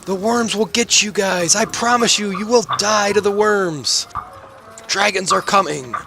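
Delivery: high, slow wailing prophet; drawn-out, high-pitched prophetic warning; doomsayer's rant. Acted. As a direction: call doom after people walking away. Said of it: "he changes the rant".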